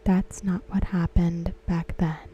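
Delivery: ordinary talk, nothing marked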